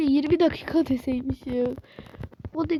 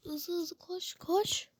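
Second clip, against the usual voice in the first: soft unvoiced slow urging; whisper; 12 dB quieter, slower than usual. Real, not acted. Urging her game character on in a whisper. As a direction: breathe the words.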